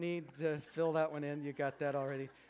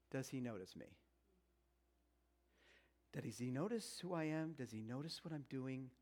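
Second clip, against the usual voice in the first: soft wistful question; 5 semitones lower, 10 dB quieter than usual. Acted. Voicing wives' quiet question.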